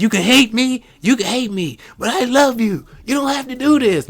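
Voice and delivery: high-pitched impassioned movie plea; high, pushed retelling of a heartfelt plea; retold desperate plea. Acting out powerful movie plea.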